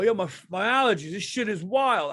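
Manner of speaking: lightly voiced Italian kid, loud and quick. Italian kid in a commercial griping about allergies, mock complaint. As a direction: pitch it up, a fast complaint. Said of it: "You never see an Italian kid in a commercial".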